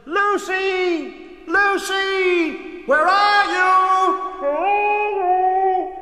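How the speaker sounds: high, loud, long drawn-out calling; shouted call. A narrator's reading. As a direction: call out for someone lost, stretching the words. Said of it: "and they shouted".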